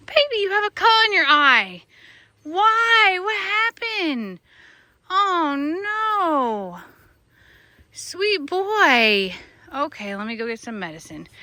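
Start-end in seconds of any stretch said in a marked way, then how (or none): 0.1-9.3 high gasp, then worried higher pitch; sudden worry over a hurt pet; alarmed concern. Real, not acted. Owner finding a cut near her horse's eye, alarmed high concern.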